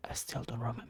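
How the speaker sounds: whispered aside, hushed. Real, not acted. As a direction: whisper it so no one hears. Aside during game cutscene.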